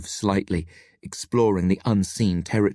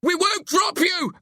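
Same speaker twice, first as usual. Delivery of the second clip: high, drawn-out yell; slower than usual. A narrator's reading. Friend reassures trapped Billy, yelling. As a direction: yell it slowly, pitched right up. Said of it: "I yell".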